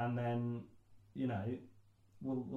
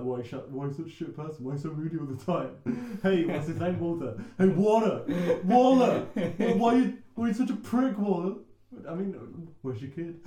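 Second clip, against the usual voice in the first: jeering louder and higher, calling out to Walter; mock Santa taunt; 4 semitones higher, 7 dB louder than usual. Santa yelling down at grieving Walter, jeering loudly. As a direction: taunt him like a gloating bully yelling across a room.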